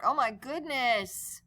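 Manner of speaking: usual speaking voice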